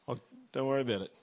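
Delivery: quick, breezy brush-off; trite well-meaning friend. Pretend. Friend brushing off your worries, quick breezy reassurance. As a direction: toss it off like a friend who means well but doesn't really listen. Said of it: "trite words of a well-intentioned friend".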